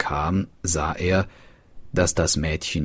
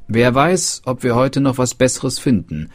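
higher and louder, a cheerful servant; servant's remark; 6 semitones higher, 4 dB louder than usual. Acted. Servant muses over the dead raven, higher and louder. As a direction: toss it off brightly to himself.

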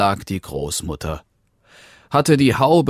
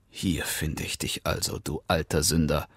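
much softer, low murmur; 3 semitones lower, 8 dB quieter than usual. Acted. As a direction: mutter it to yourself over the wolf. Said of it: "murmelte er". (leise, gemurmelt vor sich hin, der Jäger am Bett des Wolfs)

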